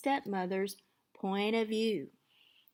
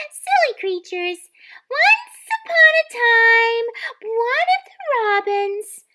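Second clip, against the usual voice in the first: put-on much higher, excited young girl; chirpy girl voice; 15 dB louder than usual. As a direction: squeak it like a dreamy girl. Cindy voiced.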